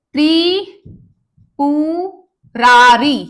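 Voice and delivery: Tripurari drawn out slowly, every syllable clear; slow careful demo. Shown as a demonstration. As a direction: spell Tripurari out slowly for the class. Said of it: "क्या होता है सही?". (धीरे-धीरे खींचकर त्रिपुरारी)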